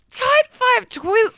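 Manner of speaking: high, slow, loud squeal; drawn-out high disbelief; incredulous repeat. Real, not acted. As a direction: squeal the title, about to laugh. Cracks up repeating the title.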